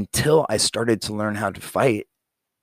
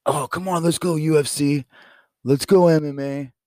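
put-on drunk friends' taunt; 7 semitones higher than usual. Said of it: "they would say".

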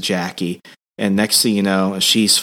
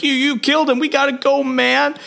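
much higher, frantic yelp; freaked-out buddy urging escape, pitched way up; panicked. Retold or imitated. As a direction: fighter telling the stabber they must run, frantic and much higher. Other brawler urges fleeing.

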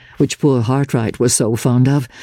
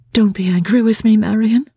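put-on gentle young woman; 9 semitones higher than usual. A narrator's reading. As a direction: plead gently as Laura. Laura's voice in narration.

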